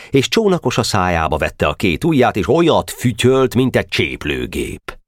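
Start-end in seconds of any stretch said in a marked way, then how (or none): none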